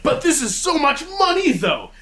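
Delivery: put-on high pleading voice, a touch childish; whiny Blue Bear. Whining to the tornado folk about money. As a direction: whine it like a greedy old bear.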